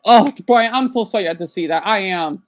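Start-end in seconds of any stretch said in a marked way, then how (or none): none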